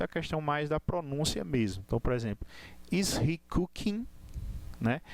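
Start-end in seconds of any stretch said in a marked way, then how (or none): 2.9-4.1 "cooking" said slowly with an open, raised -ing; open -ing ending. Shown as a demonstration. Teacher showing students the open question ending, open -ing. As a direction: lift and open the end of "cooking". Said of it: "ele fica aberto nessa expressão".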